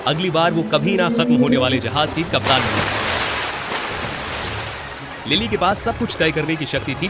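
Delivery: plain reading voice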